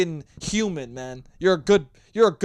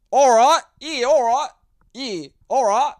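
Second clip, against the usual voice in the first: loud, higher-pitched mock Aussie accent; Australian accent; 8 semitones higher, 8 dB louder than usual. Shown as a demonstration. Podcaster proves his accent is good, loud put-on Australian accent. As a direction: belt out a hammed-up Australian accent. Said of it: "good Australian accent".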